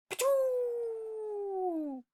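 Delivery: high hammed-up spit sound; spitting noise. A narrator's reading. Human spitting out the seed, comic spit noise. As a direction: spit it out with a pop.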